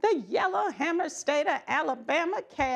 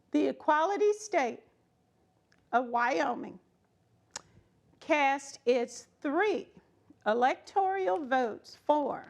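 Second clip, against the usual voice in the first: plain reading voice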